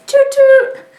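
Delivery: very high, loud "toot toot" like a toy train; mock train horn, squeaky and loud; train whistle toot. Hot Mess Express train call.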